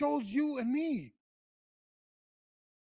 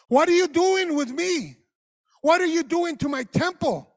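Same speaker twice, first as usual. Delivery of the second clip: higher and louder, rebuking shout; angry shout; 6 semitones higher, 9 dB louder than usual. Acted. God rebukes people for misusing his temple, angry shout.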